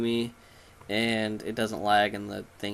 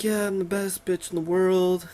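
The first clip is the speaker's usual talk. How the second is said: high, put-on boastful voice; mock a villain bragging in a raised voice; self-satisfied show-off, pitched up; 8 semitones higher than usual. Voicing boastful game villain.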